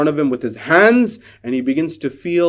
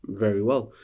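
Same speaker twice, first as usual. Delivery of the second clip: calm acknowledgement; 6 semitones lower, 10 dB quieter than usual. Acted. Sighted man calmly acknowledging.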